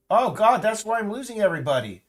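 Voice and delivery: loud, high cry of dismay; real exasperation. Real, not acted. Streamer realising why listeners are leaving, loud real dismay.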